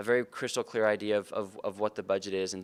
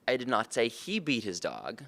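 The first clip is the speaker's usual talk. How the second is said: "he" lifted and lengthened, slow delivery; stress on HE; slower than usual. Shown as a demonstration. Sales trainer showing how the emphasized word shifts blame, stress on "he". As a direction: put all the weight on "he". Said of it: "depending on which word I emphasize".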